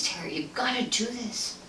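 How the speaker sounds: breathy pleading whisper; whispered plea. Retold or imitated. Ed on the phone begging Terry to stay the night, pleading whisper. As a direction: beg under your breath.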